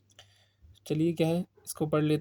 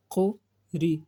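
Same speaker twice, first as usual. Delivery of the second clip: normal talking voice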